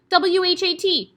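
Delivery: high, loud squeal. Acted. Dangling boy squeals the spelling. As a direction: squeal the letters in pain. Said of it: "he squealed".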